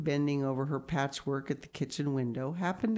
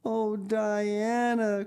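slow, high, swooning cry; emotional cry; 7 semitones higher, 4 dB louder, much slower than usual. A narrator's reading. Anne overcome by her friend's love, high slow cry. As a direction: cry out the name, long drawn. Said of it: "cried Anne".

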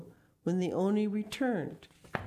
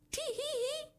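very high, tittering tee-hee; squeaky-high laugh that slips out; irrepressible giggle; 4 dB quieter than usual. A narrator's reading. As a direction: let a tiny high giggle escape. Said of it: "could not keep from laughing".

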